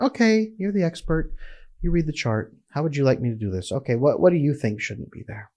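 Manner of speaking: soft, unsure, handing the decision back; a hint of a timid therapist who asks the client what to do; lightly voiced passive therapist, mostly soft; timid deferring therapist.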